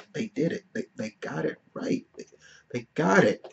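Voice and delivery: very soft, retold awe; hushed, moved. Moviegoer reliving the scene he cried through, hushed and moved. Reliving what made him cry.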